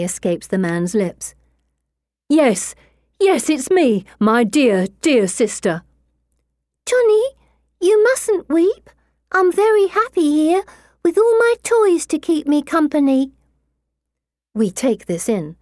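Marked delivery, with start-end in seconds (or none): 2.3-5.8 overjoyed man. Acted. Man answering his sister, overjoyed. 6.8-13.3 put-on high, sweet little girl; a sweet child's spirit calling. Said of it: "high and sweet as a girl's".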